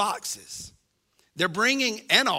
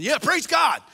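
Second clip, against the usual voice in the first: loud, high cheering praise; a hammed-up joyful yell; mock cheer; 6 semitones higher, 6 dB louder than usual. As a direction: shout it like the eager congregation. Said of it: "Some of you are going".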